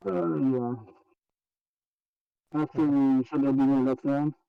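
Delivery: muffled, like talking in a pipe; through a tube. Real, not acted. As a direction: voice from inside a pipe underground. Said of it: "You're back to sounding like you're in a pipe in the middle of the ground again".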